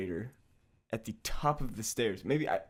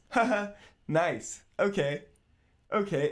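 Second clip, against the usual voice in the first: put-on girlfriend's nervous laugh; 8 semitones higher, 4 dB louder than usual. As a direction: imitate a nervous giggling girlfriend. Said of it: "his girlfriend, uh, videotapes and goes".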